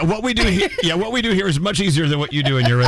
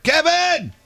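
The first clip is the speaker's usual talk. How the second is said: calling out. Real, not acted. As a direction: shout his name down the hall. Calling guest from outside room.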